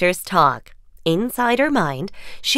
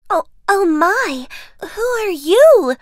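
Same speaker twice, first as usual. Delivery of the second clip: startled Cinderella; 11 semitones higher, 3 dB louder than usual. Acted. Cinderella startled by the fairy godmother, high and surprised. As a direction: gasp at a stranger appearing.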